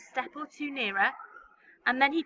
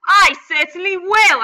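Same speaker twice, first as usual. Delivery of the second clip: loud, high, sharp snap; loud snapping aunt; snapped; 7 semitones higher, 16 dB louder than usual. Acted. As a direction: snap it back. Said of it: "Aunt Spiker snapped".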